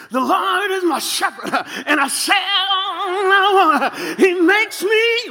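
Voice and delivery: high, slow, shouted preaching; sing-song the psalm like a hollering preacher; imitating old-school preacher. Retelling his childhood church, mimicking the preacher's high hollered chant. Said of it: "the preacher would get up and preach".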